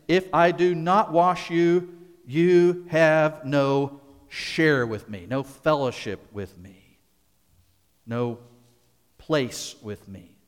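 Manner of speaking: slow, weighty, each phrase measured; slow solemn answer. Retold or imitated. Jesus answering Peter, slow and solemn. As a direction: let every word land slowly. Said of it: "Jesus answered him".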